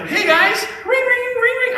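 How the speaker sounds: very high, squeaky mock-innocent ring ring; mock nerdy greeting. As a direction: play the dorky kids ringing their bells. Mocking nerdy gang greeting.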